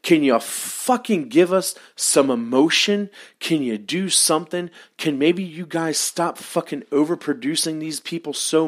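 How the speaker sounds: fed-up fan pleading with the company; exasperated rant. Real, not acted. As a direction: vent your frustration at the bosses. Ranting at WWE about promos.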